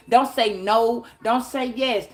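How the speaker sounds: usual speaking voice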